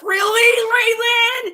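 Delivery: a cracked, shouting "really"; angry shout. Real, not acted. Angry at the commenter, shouting in disbelief. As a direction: yell it out in disbelief.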